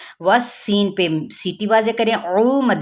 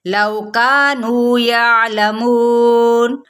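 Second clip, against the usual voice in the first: slow, melodic tajweed chant, higher pitch; Quran recitation; 5 semitones higher, much slower than usual. Shown as a demonstration. Teacher reciting verses for students, slow melodic chant. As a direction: recite each verse slowly with drawn-out vowels.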